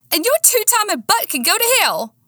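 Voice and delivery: very high-pitched, angry yell; furious outburst. A narrator's reading. Woman turning on two-timing Nick, furious. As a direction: let him have it, pitch soaring. Turns raging on cheating boyfriend.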